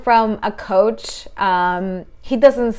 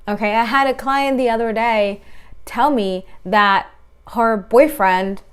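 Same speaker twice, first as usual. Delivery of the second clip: usual speaking voice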